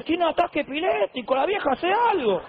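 the unbeliever alarmed by the woman behind; alarmed producer. Retold or imitated. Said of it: "este me decía".

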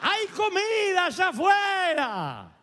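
high, slow shouted call; lepers shouting. Acted. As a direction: stretch it out and shout it to the gates. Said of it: "gritan de afuera y dicen".

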